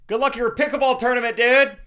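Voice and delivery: loud high yell across a plane. Retold or imitated. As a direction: shout it down the aisle. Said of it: "he yells".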